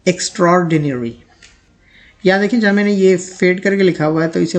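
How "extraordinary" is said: extraordinary drawn out very slowly, syllable by syllable; slow careful pronunciation. Shown as a demonstration. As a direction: spell out extraordinary slowly for the class. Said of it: "इसकी जो correct pronunciation होती है वो होती है".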